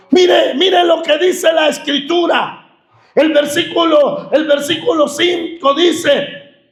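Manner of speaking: impassioned sermon peak; climactic preaching. Acted. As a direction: drive the point home to the congregation.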